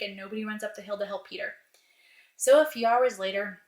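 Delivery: ordinary delivery, nothing marked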